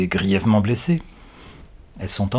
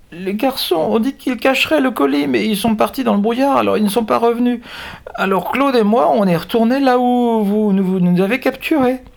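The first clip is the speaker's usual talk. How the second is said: put-on very high frightened girl voice; terrified whimper; 5 dB louder than usual. A narrator's reading. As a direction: stammer it out in terror. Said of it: "Elle émet un faible gémissement puis articule".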